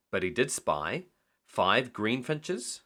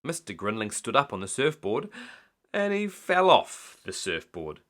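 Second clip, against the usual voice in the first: ordinary delivery, nothing marked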